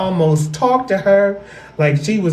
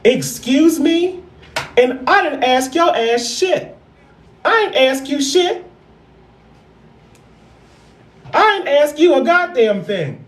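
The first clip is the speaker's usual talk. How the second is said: indignant retort; 8 semitones higher than usual. Retold or imitated. Aunt firing back at her mom over the spoiling, indignant and high. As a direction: snap back at the mother, offended.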